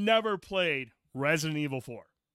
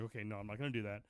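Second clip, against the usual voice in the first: fast, much softer, lower; quiet aside; 8 semitones lower, 14 dB quieter, much faster than usual. Retold or imitated. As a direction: talk yourself down quickly. Joke about voices in head.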